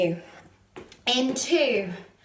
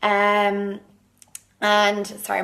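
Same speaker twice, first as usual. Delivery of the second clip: usual speaking voice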